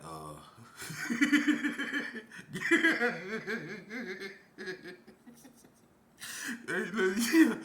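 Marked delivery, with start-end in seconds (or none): 0.6-4.3 high real laughing fit; suppressed laughter breaking out. Real, not acted. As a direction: lose composure and laugh helplessly. Composure breaks again.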